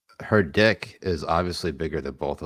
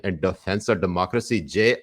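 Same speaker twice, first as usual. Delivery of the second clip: ordinary talk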